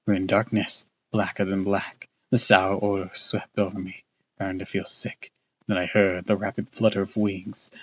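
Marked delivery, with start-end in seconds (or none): none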